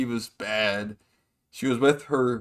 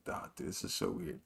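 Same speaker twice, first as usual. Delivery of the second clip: hushed embarrassed whisper; soft mock whisper of cringe; whispered aside; 4 semitones lower, 14 dB quieter than usual. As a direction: whisper it so nobody hears. Narrator's own awkward aside.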